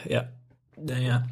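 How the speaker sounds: talking with nose held shut; pinched-nose nasal voice. Real, not acted. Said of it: "Das macht auch natürlich einen super Soundeffekt".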